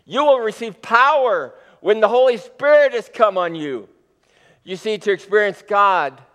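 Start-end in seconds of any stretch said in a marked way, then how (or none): 0.0-3.9 lifted higher and louder with eager energy; excited. Shown as a demonstration. Preacher rereading the promise to the church, excited. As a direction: proclaim it like thrilling news. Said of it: "but this is exciting".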